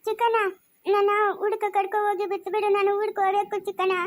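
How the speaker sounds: whiny, tearful begging to go home; tearful pleading. Acted. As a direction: plead through sobs. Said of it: "ಯಾಕೆ ಅಳ್ತಾ ಇರೋದು". (ಅಳುತ್ತಾ ಬೇಡಿಕೊಳ್ಳುವ ಧ್ವನಿ)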